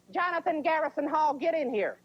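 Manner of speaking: high, sharp mock-angry call; mom summons. Mom using middle name for trouble, high angry summons. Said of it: "you're in big trouble when they use your middle name".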